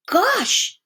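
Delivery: high startled exclaim; boy amazed at the sight; wide-eyed kid; surprised boy. A narrator's reading. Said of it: "said Arthur".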